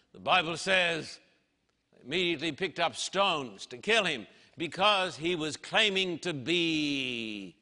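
ordinary talk

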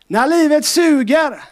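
high, slow, loud refrain; drawn-out preacher's cry; preaching refrain. Acted. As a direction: proclaim it to the church. Repeated refrain.

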